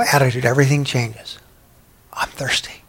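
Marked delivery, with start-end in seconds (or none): 2.1-2.8 breathy whisper of someone finally admitting need; whispered confession. Retold or imitated. The changed woman admitting her thirst to Jesus, whispered. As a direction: let it out barely above a breath.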